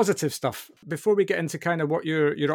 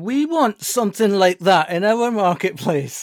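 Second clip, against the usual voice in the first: mock eager request; 5 semitones higher, 6 dB louder, slower than usual. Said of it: "somebody going".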